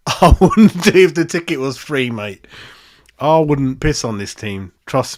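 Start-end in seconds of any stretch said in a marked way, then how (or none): 0.1-2.1 laughing through the words, louder; can't keep a straight face. Real, not acted. Host mocking his team to the chat, laughing.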